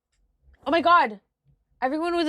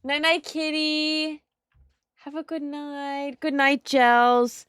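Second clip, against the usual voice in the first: sleepy talk to pet; 5 semitones higher, slower than usual. Real, not acted. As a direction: coo gently to the kitty. Saying goodnight to cat.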